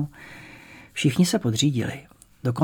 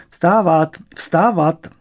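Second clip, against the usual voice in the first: loud, higher barked wake-up call; grandma's wake-up command; 4 semitones higher, 6 dB louder, much slower than usual. A narrator's reading. Grandmother ordering the boy out of bed, loud call. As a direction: grandmother rousing the house, loud and high. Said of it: "zavelela do té pohody babička".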